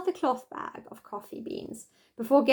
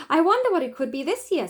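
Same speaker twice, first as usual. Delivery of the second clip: quick, eager wondering, pitched up; 6 semitones higher, 4 dB louder, faster than usual. Acted. A child wondering what the box holds, quick and eager. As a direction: rush the words out with excitement.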